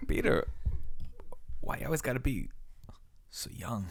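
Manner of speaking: lightly voiced Walken, halting pauses; a hint of Walken's odd pauses; Christopher Walken impression. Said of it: "delivering lines like Walken".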